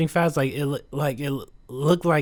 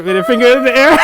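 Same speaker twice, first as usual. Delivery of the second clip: laughing; 9 semitones higher, 11 dB louder, much faster than usual. Retold or imitated. Imitating singer's high note.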